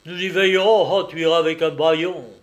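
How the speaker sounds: loud, forceful menacing retort; angry threat. A narrator's reading. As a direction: overrule the protest with a booming threat. Master threatens to gag her.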